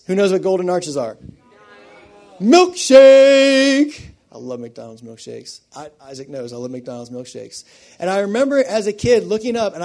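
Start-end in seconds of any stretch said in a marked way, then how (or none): 2.4-4.0 much higher and louder, gleeful; excited exclamation. Retold or imitated. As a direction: shout it like a thrilled kid.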